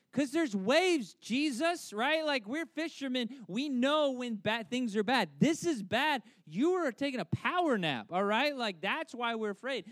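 high, loud exasperated comeback; indignant protest. Retold or imitated. Frightened fishermen answer Jesus who napped, exasperated protest. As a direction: argue back as a flabbergasted fisherman.

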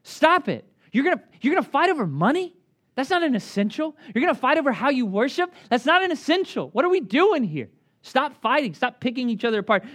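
voicing Paul scolding feuding Christians; Paul pleading. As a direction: shout at them to stop fighting. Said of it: "And Paul says".

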